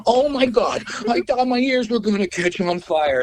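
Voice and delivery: high-pitched imitation of a panicked drummer; mock alarm. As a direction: exclaim it high, as if ears are burning. Retold drummer with burning headphones.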